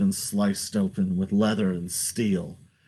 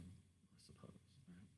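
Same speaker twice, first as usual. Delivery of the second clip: ordinary talk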